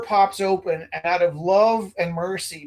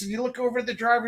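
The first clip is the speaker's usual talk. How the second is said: ordinary talk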